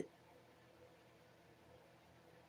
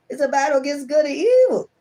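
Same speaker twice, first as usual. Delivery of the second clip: high, loud emphatic declaration; 8 semitones higher, 4 dB louder than usual. Real, not acted. Reader repeats card meaning emphatically.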